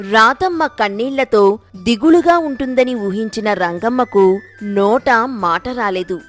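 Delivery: ordinary delivery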